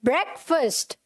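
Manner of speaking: ब्रेकफास्ट said "BREK-fust"; short vowels in both halves of ब्रेकफास्ट; correct pronunciation. Shown as a demonstration. Teacher modelling for students, ब्रेकफास्ट as "BREK-fust". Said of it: "तो कैसे बोलेंगे इसे?".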